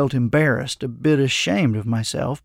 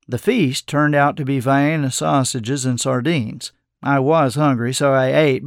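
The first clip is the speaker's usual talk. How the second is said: ordinary delivery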